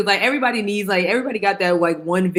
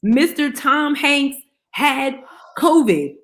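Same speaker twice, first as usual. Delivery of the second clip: slow dramatic reveal; 7 semitones higher, much slower than usual. Acted.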